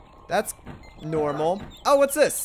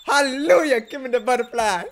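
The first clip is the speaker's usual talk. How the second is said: greedy mantis; 3 semitones higher than usual. Retold or imitated. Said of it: "He's like".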